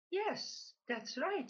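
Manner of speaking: a high, eager yes that settles into "that's right". A narrator's reading. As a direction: landlord keen to close the deal. Landlord confirms to duck.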